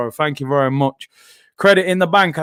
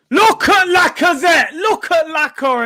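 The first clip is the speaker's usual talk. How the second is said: excited shout; 10 semitones higher, 3 dB louder than usual. Real, not acted. Fan hyping Lacazette's work rate, excited shout. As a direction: point him out to everyone, thrilled.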